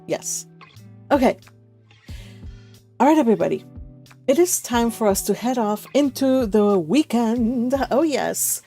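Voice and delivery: ordinary delivery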